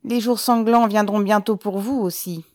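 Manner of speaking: loud prophetic threat. A narrator's reading. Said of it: "continua Ribine en haussant la voix".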